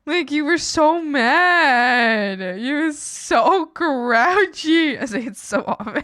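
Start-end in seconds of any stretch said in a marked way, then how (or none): 0.0-5.0 high, loud, slow, laughing through it; laughing mock grouchy. Friend teases her, laughing loudly and slowly. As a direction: tease the grumpy delivery. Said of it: "why did you say it like that".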